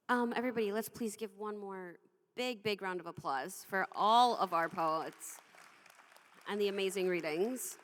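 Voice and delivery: rally the room to clap; loud host call. Real, not acted. Host to audience, calling for applause loudly.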